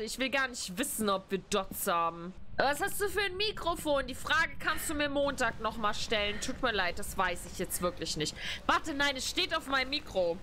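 normal talking voice

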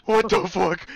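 loud, high freaked-out yell; alarmed shout. Real, not acted. Alarmed at monster.

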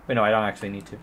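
ordinary delivery

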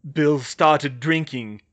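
slow, loud, heavy delivery; thick, leaden. A narrator's reading. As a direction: weigh down each word, slowly. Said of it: "his voice thick and leaden".

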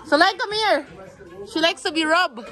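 usual speaking voice

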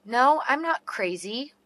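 ordinary talk

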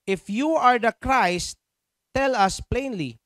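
slow, measured public Bible reading; scripture reading. Acted. Preacher reading John ten to congregation, slow scripture reading. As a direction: read it out slowly, stretching the phrases.

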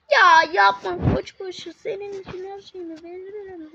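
loud, slow begging cry; real anguish; loud pleading. Real, not acted. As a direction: beg the ram not to fail. Pleading with the battle ram.

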